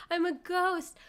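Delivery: squeaky high ghost voice; mock ghost. To chat, mock ghost line in high voice. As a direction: pipe it up high like a silly ghost. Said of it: "it's just like my Ghoul Boy song".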